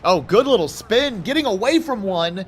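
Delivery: excited play-by-play for the runner; excited call. Real, not acted. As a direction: cheer the spin move. Big run by Portis.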